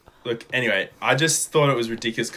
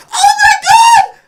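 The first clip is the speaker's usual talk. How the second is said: high, loud mock shriek of panic with a laugh; shocked scream; 16 dB louder, slower than usual. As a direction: shriek it like she's watching her bag fly off a cliff. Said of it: "She's like".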